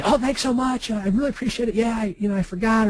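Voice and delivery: high, chirpy thankful customer voice; lightly voiced grateful customer. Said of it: "They say".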